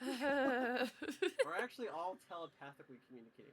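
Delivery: laughing through the words. Real, not acted. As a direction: joke it while laughing. Joking about silent play.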